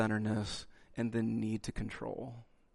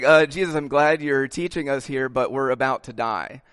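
panicky disciples; 2 semitones higher, 9 dB louder than usual. Retold or imitated. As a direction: play the frightened disciples, half joking. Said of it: "The disciples are like".

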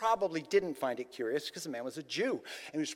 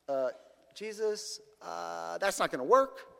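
hesitant refusal. Acted. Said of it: "the guy went away sad".

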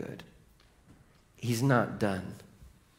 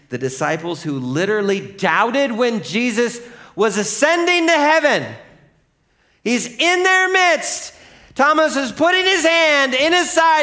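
loud, high, incredulous build; full-force preaching; 12 dB louder than usual. Real, not acted.

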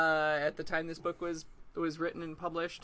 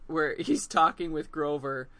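ordinary talk, nothing marked

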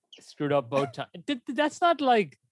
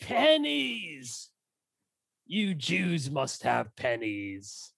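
cartoon cockney villain; hammed-up London accent; mock cockney voice; 2 semitones higher, much slower than usual. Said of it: "doing it British".

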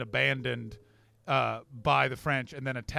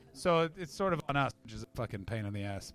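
ordinary delivery

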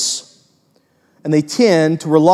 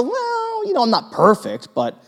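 mock self-justifying; 7 semitones higher than usual. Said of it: "people go".

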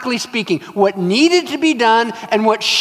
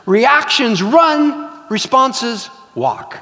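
slow emphasis; 4 semitones higher, much slower than usual. Shown as a demonstration. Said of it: "slow emphatic teaching contrast".

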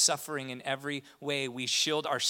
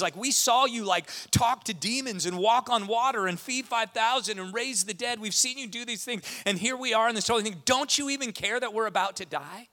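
much higher, louder, pleading rant; exasperated plea; 10 semitones higher, 9 dB louder than usual. Retold or imitated. Preacher voicing his own complaint to Jesus, loud high rant. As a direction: build a heated mock complaint at Jesus. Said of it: "And I'm like".

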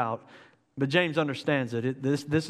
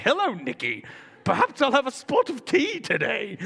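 hammed-up posh British accent, pitched higher; playful British tea-talk voice, higher; mock British accent; 8 semitones higher than usual. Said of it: "a British guy reads it to me".

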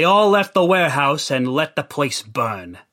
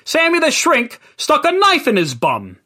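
ordinary delivery

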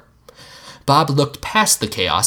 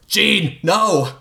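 cried; 8 semitones higher than usual. Acted. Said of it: "Bob cried".

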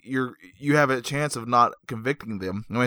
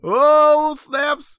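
loud, high drawn-out whoop; hammed-up excitement; 10 dB louder than usual. Cohost reacting to nineteen sixty-nine, exaggerated excited shout. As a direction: cheer it in mock amazement.

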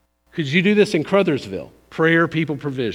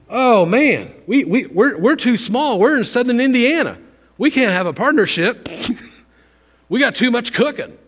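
higher-pitched excuse-making, lightly hammed up; mock complaining; 7 semitones higher, 4 dB louder than usual. Preacher mimics small church making excuses, mock complaining. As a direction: protest like a reluctant church member, in a raised voice.

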